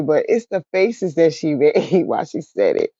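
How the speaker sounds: usual speaking voice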